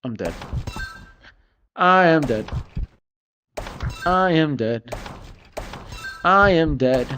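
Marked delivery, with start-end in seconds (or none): none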